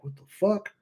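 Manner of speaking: high disbelieving what-the-; baffled. Retold or imitated. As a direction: voice a stunned coworker's disbelief. Coworker's baffled look voiced.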